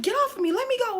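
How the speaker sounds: high-pitched mock cry to be released; retold protest. Retold plea to the grabber.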